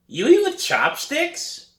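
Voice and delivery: high, slow teasing brother voice; mocking little brother. A narrator's reading.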